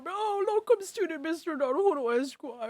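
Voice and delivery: put-on high, excited old man crying out; overjoyed old man, near tears. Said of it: "Can't wait to do more old man voice".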